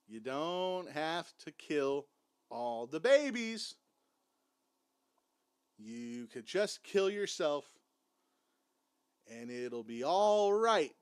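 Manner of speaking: slow, drawn-out mock appeal; mock pleading. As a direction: stretch each phrase out, reasoning with him in jest. Mock pleading with cult leader Jim.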